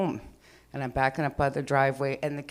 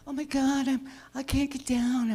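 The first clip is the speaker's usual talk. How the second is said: high, hammed-up whiny cat voice; dramatic cat; 9 semitones higher than usual. The cat stuck in the tree whining at the owner, high and dramatic. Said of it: "all drama".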